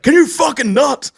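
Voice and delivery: loud, high, slow, fed-up retort; loud irritated plea to stop; exasperated snap. Retold or imitated. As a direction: let her snap at the man shaking her seat. Annoyed woman in front seat.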